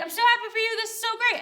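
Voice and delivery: high, loud gushing; high-pitched retold delight; delighted congratulation. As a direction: gush it, imitating a thrilled pastor. Acts out pastor's delighted reaction.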